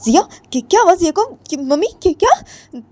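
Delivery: high, urgent what happened; alarmed questions. Acted. Ritu pressing her mother-in-law about Jiya, alarmed and high. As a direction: panic rising about Jiya. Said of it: "तो रितु बोलती है".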